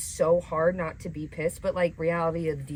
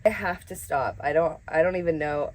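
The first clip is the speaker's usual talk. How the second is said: ordinary talk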